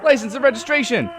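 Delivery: high, loud frantic cop yell; screamed demand. A narrator's reading. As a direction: scream it like a deranged officer. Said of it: "screams in bloody".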